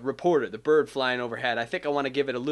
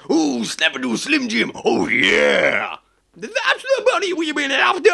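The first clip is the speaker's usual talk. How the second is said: higher, louder wrestler growl with "oh yeah"; Macho Man Randy Savage; 12 semitones higher, 6 dB louder, slower than usual. Acted. Rooster line slipping into a wrestler impression. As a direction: ham it up like a wrestling promo. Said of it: "like Macho Man Randy Savage".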